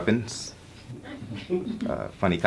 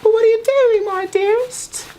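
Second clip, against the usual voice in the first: very high falsetto, louder, drawn out slowly; mock woman's voice; 6 dB louder, slower than usual. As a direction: squeak it out like a swooning lady. He voices the woman in his scene.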